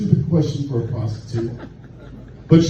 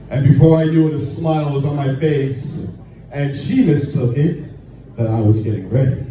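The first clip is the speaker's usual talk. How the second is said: normal talking voice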